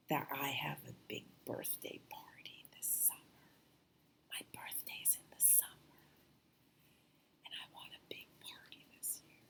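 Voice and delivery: breathy secret whisper. Real, not acted. Making a birthday wish to the star, whispered. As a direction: whisper the wish so nobody hears.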